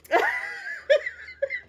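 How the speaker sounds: high shrieking laugh. Real, not acted. Laughing at own story.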